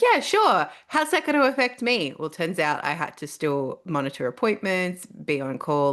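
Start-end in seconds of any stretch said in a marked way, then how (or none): 0.0-2.1 breezy eager yes. Retold or imitated. Staff asking to work Saturdays.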